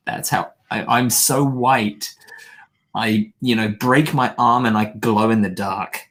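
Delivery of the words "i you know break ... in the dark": ordinary talk, nothing marked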